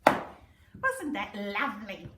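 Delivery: ordinary delivery, nothing marked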